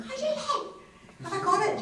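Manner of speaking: very high, squealed greeting; breathless-with-hope squeak, hammed up; overeager excitement. Actress answers the casting assistant's call, overeager squeal.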